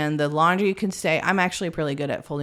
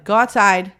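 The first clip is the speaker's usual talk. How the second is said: louder, higher parental command; retold loud order; 4 semitones higher, 9 dB louder than usual. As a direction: send the kids out, acting fed up. Retold overwhelmed mom sending kids out.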